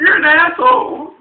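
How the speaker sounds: high, loud, slow mock insult; imitating wife, loud and high; loud mock accusation. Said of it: "She's like".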